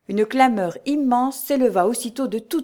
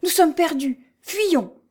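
much higher-pitched, frightened exclamation; panicked cry; 9 semitones higher, 4 dB louder, slower than usual. A narrator's reading. Cantinière wants to flee up the stairs, panicked cry. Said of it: "cria la cantinière".

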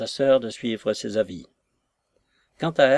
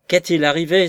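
higher, louder frantic question; near-delirious cry; 4 semitones higher, 6 dB louder than usual. A narrator's reading. Lover begging the maid for news, frantic. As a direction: cry out, beside yourself with worry. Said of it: "s'écria Jones, dans un transport qui ressemblait presque au délire".

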